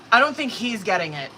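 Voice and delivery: usual speaking voice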